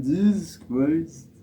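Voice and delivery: high, drawn-out stoner imitation; stoner accent. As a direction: drag it out like someone stoned. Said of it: "that's a stoner accent".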